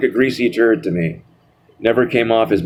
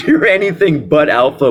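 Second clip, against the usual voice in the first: higher, through a real laugh; laughing mockery; 9 semitones higher than usual. Real, not acted. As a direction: scoff while cracking up. Laughs while mocking the self-styled alpha.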